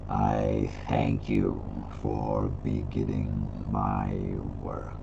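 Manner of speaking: deep, slow ancient dragon voice; low slow rumbling elder; old man voice. Acted. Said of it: "Old man voice, what?".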